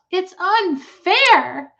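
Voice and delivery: high, slow wail of unfairness; tearful protest. A narrator's reading. Fern protests to her father through tears. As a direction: cry it out, stretching unfair. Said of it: "she sobbed".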